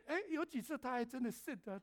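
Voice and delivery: ordinary talk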